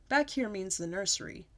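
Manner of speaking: ordinary delivery, nothing marked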